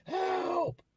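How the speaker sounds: very high, drawn-out mock scream; imitated scream for help. Young inmate attacked in his cell, imitated scream for help. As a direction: act out a piercing scream for rescue. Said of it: "He starts screaming for help".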